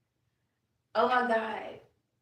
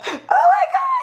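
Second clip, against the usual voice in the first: much higher, loud crying shout; tearful shout; 13 dB louder than usual. Real, not acted. Overwhelmed by her grandfather's answer, a tearful shout. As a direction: cry out overwhelmed.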